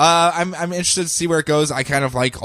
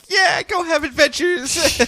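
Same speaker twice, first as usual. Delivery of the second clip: cheerful send-off; slower than usual. Retold or imitated. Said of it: "I was like".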